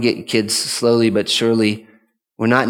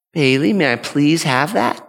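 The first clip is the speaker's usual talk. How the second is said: sweet, higher polite request; nice tone; 4 semitones higher than usual. Shown as a demonstration. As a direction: ask nicely, with kind politeness. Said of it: "Oh, that's nice".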